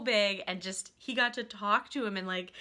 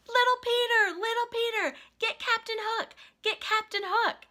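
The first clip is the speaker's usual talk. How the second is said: high-pitched chanting encouragement; retold cheering; 12 semitones higher, 4 dB louder than usual. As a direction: imitate characters egging a child on. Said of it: "cheering for him".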